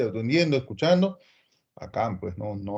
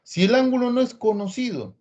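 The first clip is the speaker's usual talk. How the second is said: scared student; 7 semitones higher than usual. Retold or imitated. Student frightened by an unknown angle, anxious and pleading. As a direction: play the alarmed pupil asking for help. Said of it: "el alumno se asusta y dice".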